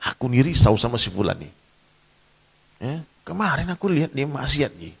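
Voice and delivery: confiding gossiper leaning in close; sly gossip. Retold or imitated. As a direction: lure a friend aside to share gossip. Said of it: "kan setan nih licik".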